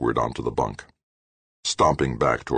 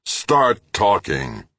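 louder, slow, hammered out; furious demand; 6 semitones higher, 4 dB louder, much slower than usual. A narrator's reading. Grant demanding answers from Halleck, slow and furious. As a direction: force every word.

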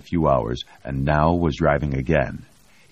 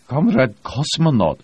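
pointed objection; 10 semitones higher, 5 dB louder than usual. A narrator's reading. Pilot objects to commander.

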